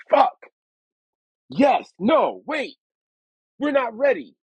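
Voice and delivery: flip-flop in a panic; panicked excitement. Retold or imitated. Answering Flex before the premiere, high and panicked. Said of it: "I'm like".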